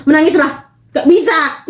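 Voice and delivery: ordinary delivery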